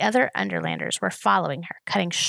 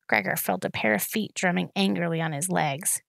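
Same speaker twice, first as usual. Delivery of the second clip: plain reading voice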